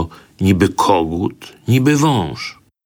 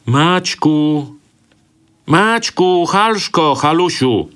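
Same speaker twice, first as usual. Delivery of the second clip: calling out; 10 semitones higher, slower than usual. A narrator's reading. Servant calls the lost children from the street. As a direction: call the children from afar. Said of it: "nawoływanie z ulicy".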